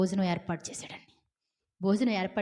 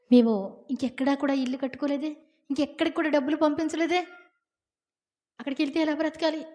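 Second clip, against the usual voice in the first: higher, fast anxious complaint; fretting wife; 6 semitones higher than usual. Retold or imitated. Lot's wife fretting about leaving, higher and fast. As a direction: rattle off worries as the wife.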